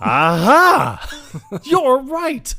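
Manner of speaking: loud, high burst of triumph; hammed-up eureka moment; mock realization. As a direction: exclaim it like a delighted sidekick. Sidekick's acted sudden realization.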